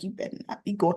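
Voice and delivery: hushed, soft threat from a glaring wife. Retold or imitated. Wife glaring at Victor, warning him quietly under breath. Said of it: "She's just looking at him like".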